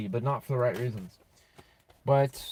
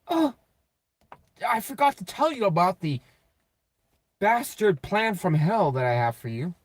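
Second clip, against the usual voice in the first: a lightly voiced sly schemer, pitched up and sing-song; mock manipulator voice; 5 semitones higher than usual. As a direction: tease them like a smug con artist offering a deal. Mocking voice of the manipulator.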